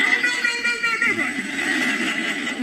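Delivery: high, fretful repeated no; mimicking the flustered girl. Ride attendant protesting, high fretful imitation. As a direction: plead with them not to.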